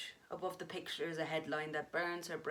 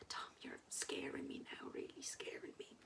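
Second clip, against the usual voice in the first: frightened whisper; 11 dB quieter than usual. Acted. Kate frightened by Tom.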